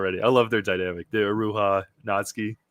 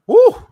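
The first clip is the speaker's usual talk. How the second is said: high, loud, sudden whoop; excited whoop; 14 dB louder than usual. Real, not acted. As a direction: yelp in amazement. Sword draw stuns the reader.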